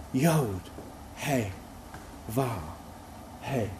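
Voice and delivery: "Yod, He, Vav, He" softly, a pause after each; "Yod, He, Vav, He" spaced out like slow breathing. Shown as a demonstration. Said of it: "almost like the sound of breathing".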